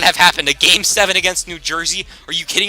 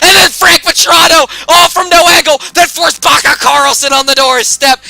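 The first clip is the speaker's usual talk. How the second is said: loud, high, rapid hype call; high and loud excited commentary; excited play-by-play; 9 semitones higher, 8 dB louder than usual. Real, not acted. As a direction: call the goals like a fan going wild.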